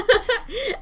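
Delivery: bright burst of laughter; sudden high laughing burst; loud laugh. Real, not acted.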